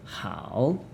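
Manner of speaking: "hao" soft, low, dipping then rising; teacher tone. Shown as a demonstration. Teacher demonstrating the word good, "hao" dipping then rising. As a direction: scoop "hao" down and up. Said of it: "also teacher tone".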